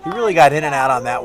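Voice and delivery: loud, thrilled shout of a clean hit; excited play-by-play. Real, not acted. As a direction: call the hit like a live announcer.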